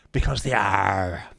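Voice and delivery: long, loud piratical "arr"; pirate growl. Acted. Streamer delivering a pirate pun to viewers, pirate voice. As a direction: land the punchline like a slow pirate.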